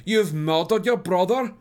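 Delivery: loud, slow, high cry of despair; anguished cry. A narrator's reading. Mother cries out at her daughter, loud and anguished. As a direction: wail it out as if the floor gave way. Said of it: "cried Madame Charpentier".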